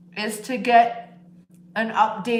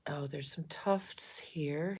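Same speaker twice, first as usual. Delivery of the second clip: slow whisper while feeling the fur; 6 semitones lower, 12 dB quieter, slower than usual. Real, not acted. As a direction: breathe it slowly, no voice. Whispering over the cat.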